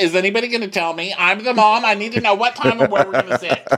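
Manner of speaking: a mom badgering about lunch, laughing; mock shrill mom demanding answers; pushy mom. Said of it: "she's like".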